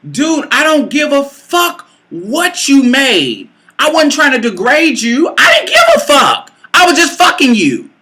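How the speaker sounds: high, heated comeback breaking into a yell; exasperated retort. Retold or imitated. Woman denying she meant to degrade him, exasperated outburst. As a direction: push the pitch up, dismissing him angrily.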